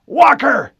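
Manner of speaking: high, loud shout of a name. A narrator's reading. Harlan shouting for Walker under fire. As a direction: yell for him across the vehicle. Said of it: "he cried".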